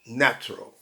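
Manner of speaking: short reduced "Natural"; fast two-syllable natural. Shown as a demonstration. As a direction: swallow the middle vowel of "Natural". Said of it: "quer falar rápido".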